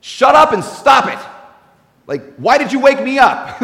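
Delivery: a playful irritated "shut up"; annoyed rebuke. Retold or imitated. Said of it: "it actually should read something like this".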